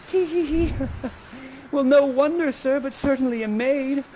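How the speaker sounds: tee-hee it, then answer high and coy; Miranda. Acted. Miranda answering Ferdinand's wooing, mock-giggly high voice. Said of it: "And she goes".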